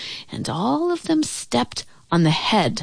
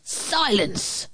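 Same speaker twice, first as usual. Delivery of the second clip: imperious order, pitched up; barked command; 4 semitones higher, 5 dB quieter than usual. A narrator's reading. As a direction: cut the subject off. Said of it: "the king of the turtles barked back".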